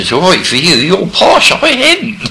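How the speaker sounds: a teasing high voice; mock posh retort. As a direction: tease her in a high put-on voice.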